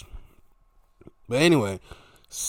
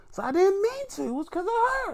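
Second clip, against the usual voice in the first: high-pitched mock accusation; put-on falsetto complaint; mock squabbling wrestler. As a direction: squeal it like an indignant wrestler, played for laughs. Acted ring argument between wrestlers.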